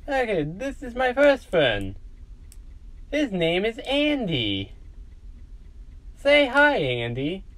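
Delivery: put-on high-pitched, childlike show-and-tell; high innocent character voice; eager introduction. Caboose proudly introduces new friend.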